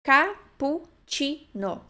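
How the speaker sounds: Cappuccino drawn out, ka-poo-chee-no; careful teacher demo; slow model word. Shown as a demonstration. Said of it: "Qual é o problema".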